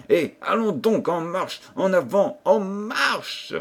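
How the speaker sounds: high, ringing captain's rallying speech; vibrant harangue. A narrator's reading. Captain shaming his soldiers into action, vibrant. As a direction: goad the troops into marching. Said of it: "d'une voix vibrante".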